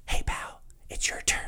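soft whisper "hey, pal"; whispered nudge. Pretend. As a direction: whisper it like a gentle hint. Gentle horn voiced.

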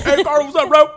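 high, loud hailing shout; calling out. Retold or imitated. Imitating someone hailing Carlos abroad, loud high call. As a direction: holler it like greeting a buddy from afar.

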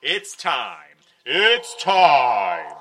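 booming show announcer; big dramatic announcement. Acted. Host announces segment dramatically.

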